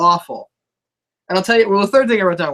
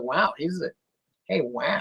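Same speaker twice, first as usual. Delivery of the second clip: Owen Wilson; 9 dB quieter than usual. Retold or imitated. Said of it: "kinda like Owen Wilson".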